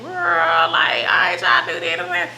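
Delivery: mock indignant shout. Teen self refusing mom's talk, mock shout. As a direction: yell it like no way. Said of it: "And I'm like".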